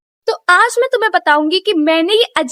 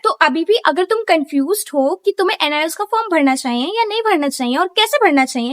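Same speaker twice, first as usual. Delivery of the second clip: ordinary talk, nothing marked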